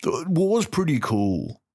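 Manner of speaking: lightly voiced dumb bro, slow and awed. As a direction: drawl it like a clueless bro. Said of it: "it was kinda just people like".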